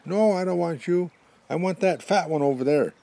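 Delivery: high, loud holler of rejection; shouted refusal. Acted. Porcupine turns down the skinny buffalo, shouting across.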